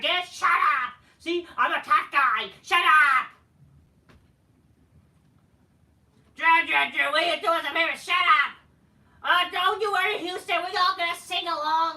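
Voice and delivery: high, very loud hammed-up yelling; mock tough-guy shouting. Tough guy shouting down Drew and Houston, hammed-up yell. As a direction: shout over a whole crowd like a blustering wrestler. Acted wrestler shouting down the crowd.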